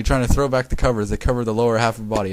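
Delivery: plain reading voice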